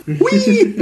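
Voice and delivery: squealing laugh with a high whoop; high giggle. Real, not acted. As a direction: burst out giggling, squeak a hui. Laughing burst after joke.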